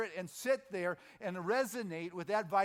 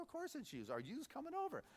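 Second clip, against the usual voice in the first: inner voice insisting; 8 semitones higher, 8 dB quieter than usual. Retold or imitated. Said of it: "And he thought".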